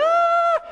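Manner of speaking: very high, drawn-out shriek; hammed-up falsetto scream. Skydiver falling down, acting out his scream. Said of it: "I'm screaming".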